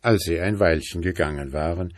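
usual speaking voice

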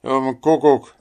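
loud, high outburst of anger; highly indignant. A narrator's reading. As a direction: explode in angry exasperation. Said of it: "sagte der Wasserratz höchst erbost". (höchst erboster, lauter Ausruf)

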